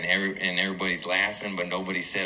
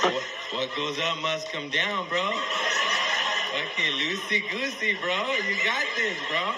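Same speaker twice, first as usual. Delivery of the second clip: high, slow, drawn-out stoner coach; higher, slow, laughing surfer-bro pep talk; stoned buddy coaching; 7 semitones higher, slower than usual. Retold or imitated. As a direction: stretch loosey-goosey way up like a baked friend. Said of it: "my buddy Adam's coaching me".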